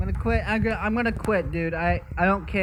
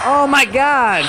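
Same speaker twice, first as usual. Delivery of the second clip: loud, higher, slow panicked cry; startled yelp; 6 semitones higher, 9 dB louder, slower than usual. Real, not acted. Player caught by the monster, panicked yell. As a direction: cry out as it jumps you.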